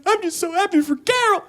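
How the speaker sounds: very high, tearful gush; hammed-up happy crying voice; mock weepy girlfriend. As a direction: sob it out with joy. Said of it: "she's like".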